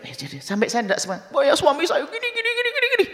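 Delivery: high, breathless, rattled complaint; complaining wife. Retold or imitated. Preacher imitating a wife complaining, very high voice. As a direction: gabble on in a high pitched woman's voice.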